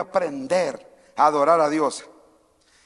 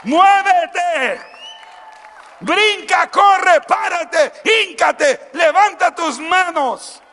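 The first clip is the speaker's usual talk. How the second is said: much higher, louder rallying shouts; shouted commands; 10 semitones higher, 6 dB louder, much slower than usual. Acted. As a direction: fire up the crowd, shout each command. Calling congregation to move.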